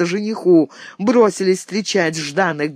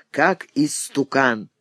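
ordinary delivery

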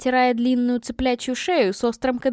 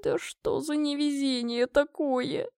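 slow, soft, higher-pitched moan; drawn-out exasperated lament; muffled groan; 4 semitones higher, 7 dB quieter, slower than usual. Acted. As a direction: groan it quietly into your hands. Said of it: "со стоном уткнулась лицом в ладони".

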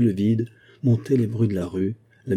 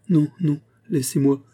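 higher, broken stammering no; stammering through tears; 8 semitones higher than usual. A narrator's reading. Denise pushing Pauline away, tearful stammer. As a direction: push her away, stammering. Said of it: "bégayait Denise".

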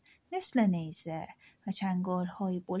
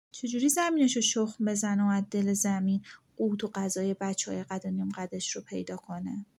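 ordinary delivery

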